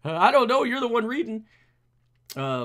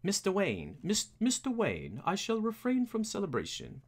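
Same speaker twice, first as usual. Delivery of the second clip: Alfred; 4 dB quieter than usual. Acted. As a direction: try a formal butler, pitched up. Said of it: "Anybody know what Alfred sounds like?".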